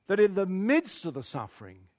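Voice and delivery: ordinary delivery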